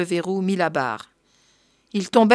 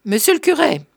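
raised, high call upstairs; pitched-up hail, moderately loud; 7 semitones higher, 4 dB louder than usual. A narrator's reading. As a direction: call for the priest, short of a full shout. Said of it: "Il appela".

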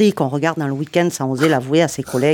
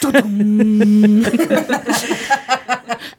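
loud mouth-made Netflix intro sting; Netflix sound imitation; 5 semitones higher, 4 dB louder than usual. Shown as a demonstration. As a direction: boom out the Netflix jingle with a long held tail, then laughter. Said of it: "je fais le truc Netflix".

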